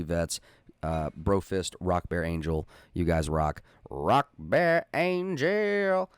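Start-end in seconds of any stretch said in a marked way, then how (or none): 3.9-6.1 drawn-out high shout-out; high, stretched-out call. Acted. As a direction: sing the name out high and slow. Shout-out name said twice.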